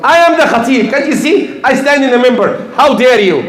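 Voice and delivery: high-pitched mock outrage of a self-important preacher; indignant boasting. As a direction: act out puffed-up indignation. Acts out ego-driven preacher's indignant boast.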